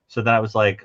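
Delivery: ordinary delivery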